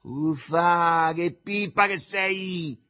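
high, exasperated yell; son shouting back. A narrator's reading. Son fed up with his mother, shouting. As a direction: shout it, dragging out the last words. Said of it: "gridò Arduccio".

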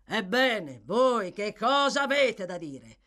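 loud, impatient question; ill-tempered demand. Acted. Magistrate questions the sworn witness, grudging and loud. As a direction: demand it with bad grace. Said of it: "assai di mala grazia".

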